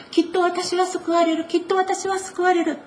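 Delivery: high, pleading repetition of a hopeful vow; the sick woman repeating her hope of healing, high and pleading; repeating to herself. Acted. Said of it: "言い続けたんです".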